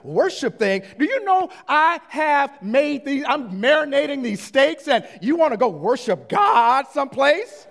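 loud, high-pitched incredulous outburst; indignant inner rant. Retold or imitated. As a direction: rant like a dad who's been wronged. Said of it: "In my mind, I'm like".

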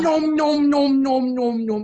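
high, loud singsong nom noms; nom nom chomping. Real, not acted. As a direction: chant the nom noms while eating. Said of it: "enak kali makannya ya".

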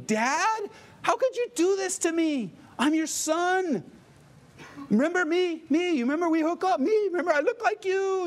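high, pleading whine at dad; crying with rage. Retold or imitated. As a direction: wail it like a betrayed son. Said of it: "And the kid's like".

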